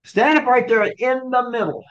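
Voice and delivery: high, emphatic public order; called-out command. Acted. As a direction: project it to the whole synagogue. Public command, contrasted with private whispering.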